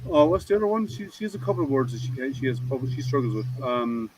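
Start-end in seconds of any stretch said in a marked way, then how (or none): none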